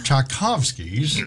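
ordinary delivery

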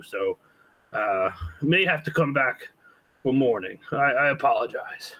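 put-on a frail old man apologizing; old shopkeeper voice.

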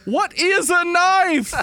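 high, drawn-out bad Australian accent; attempted Australian accent. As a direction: flounder in an over-pitched Australian accent. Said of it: "I'd be like".

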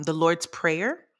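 ordinary delivery

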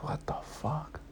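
stunned whispered disbelief; hushed what-the-fuck. Retold or imitated. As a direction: breathe it out in shock. Stunned reaction to her request.

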